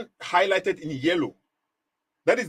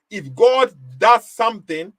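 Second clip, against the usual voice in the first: ordinary delivery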